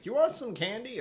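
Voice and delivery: high, loud mock offer; creepy candy offer. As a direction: pitch it way up, hammed-up offering of sweets. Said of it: "a bit weird to be like".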